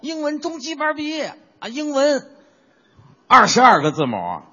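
a hint of puffed-up bragging, parroting him; mocking partner. Teasing his partner by parroting his boast, lightly mocking. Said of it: "你可倒好".

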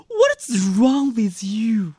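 high, loud mock-angry English, slowly drawn out; indignant. Young Chinese man to foreigner who hit him, indignant. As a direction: stretch out an outraged challenge. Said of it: "非常气愤地说".

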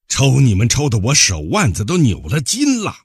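loud, high angry shout; captain shouting. Acted. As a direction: yell it out at the prisoners. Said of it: "船长终于叫道".

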